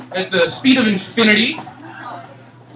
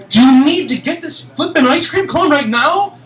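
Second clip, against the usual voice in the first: sharp, higher incredulous question; incredulous retort; 7 semitones higher, 4 dB louder than usual. Retold or imitated. To the friend over ice cream, incredulous scolding.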